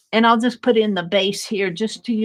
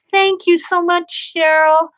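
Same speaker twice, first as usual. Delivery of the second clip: higher and brighter, gushing; excited thanks; 8 semitones higher, 4 dB louder than usual. Real, not acted. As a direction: burst out with delighted thanks. Viewer's gift moves her.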